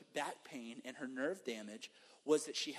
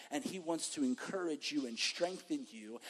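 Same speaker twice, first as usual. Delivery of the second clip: usual speaking voice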